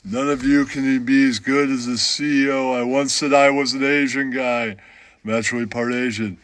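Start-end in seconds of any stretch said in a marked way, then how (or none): none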